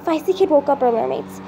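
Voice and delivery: usual speaking voice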